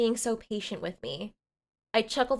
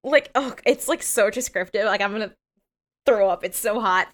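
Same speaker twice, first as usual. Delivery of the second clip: ordinary talk, nothing marked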